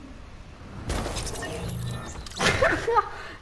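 airy high-pitched giggle. Real, not acted. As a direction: giggle with relief. Ride dopo vittoria. (risatina acuta soffiata)

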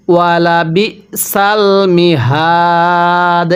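slow, drawn-out tajweed chant; Quran recitation. Shown as a demonstration. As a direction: recite it slowly, stretching the vowels. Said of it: "আমার সাথে পড়ুন". (ধীরে টেনে কুরআন তিলাওয়াত)

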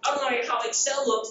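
hammed-up high complaint from a user; mock user complaint. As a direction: pipe up like a user whining about Outlook. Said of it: "going to work and saying".

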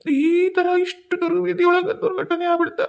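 high, slow and sobbing. Acted. Husband lamenting his wife's fate, high, slow and sobbing. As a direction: let the grief break the words.

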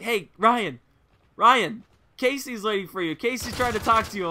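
imitated calling out. Friend Tyler alerts Ryan in class, calling out teasingly. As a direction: call over to a friend who is ignoring you.

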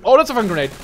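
loud, high shout of panic at a grenade; alarmed shout. Real, not acted. Gamer seeing a grenade land by him, panicked shout. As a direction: yell it out as it lands. Grenade lands at his feet.

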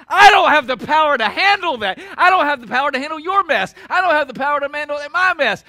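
loud, high, emphatic cry from the pulpit; impassioned preaching shout. Real, not acted. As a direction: belt out each repeat to the congregation. Preacher's climax, confessing powerlessness.

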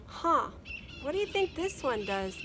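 put-on high, lively kid voice; wondering aloud; curious boy voice. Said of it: "Matt asked".

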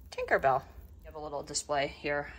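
ordinary talk

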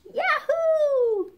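very high, drawn-out whoop; gleeful high screech. A narrator's reading. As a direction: let out a long piercing holler. Parrot's screech in the song.

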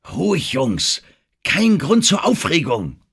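laut und hoch warnend; warnender Zuruf. A narrator's reading. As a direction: rufe die Männer warnend zur Ruhe. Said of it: "rief ihnen warnend zu". (warnend rufen, immer lauter)

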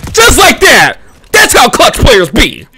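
high, loud hype; hype callout. Acted. Gamer hyping his clutch defuse, high and loud. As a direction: crow over the clutch play. Hype catchphrase after kill.

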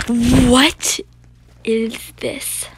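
loud, rising, drawn-out exclamation; shocked disbelief. Real, not acted. Gamer seeing where they landed, stunned outburst. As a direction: blurt it loudly in disbelief.

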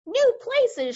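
high, loud outburst of disbelief; incredulous shout. A narrator's reading. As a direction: shout it back as an appalled commander. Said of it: "shouted the head of the Air Force".

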